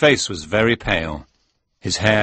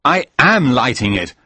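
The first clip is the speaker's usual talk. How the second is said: higher, louder, exasperated snap; angry retort; 4 semitones higher, 5 dB louder than usual. Acted. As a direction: snap back in anger. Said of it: "I said angrily".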